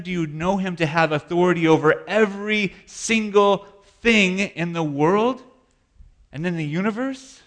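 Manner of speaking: preaching at full force. Real, not acted. Preaching builds to climax.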